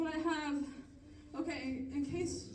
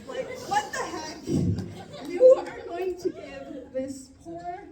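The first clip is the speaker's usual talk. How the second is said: loud, slow, incredulous outburst with a laugh; exasperated outrage; 4 semitones higher, 10 dB louder, much slower than usual. Real, not acted. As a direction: hammer each word out high and loud. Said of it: "I'm still mad about it".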